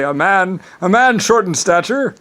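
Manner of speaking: high, loud mock-quizzical quote; imitating puzzled people. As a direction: voice their query, rising at the end. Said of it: "some people would say".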